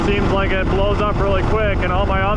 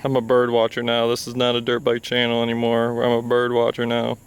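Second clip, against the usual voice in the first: nature documentary; 8 semitones lower, 4 dB quieter than usual. Pretend. Opens as mock wildlife documentary.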